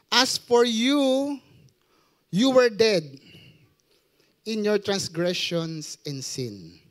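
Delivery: slow, solemn Bible reading; scripture reading. Acted. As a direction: read the verse slowly and gravely. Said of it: "Sabi ron sa Ephesians two". (mabagal at taimtim na pagbasa ng Bibliya)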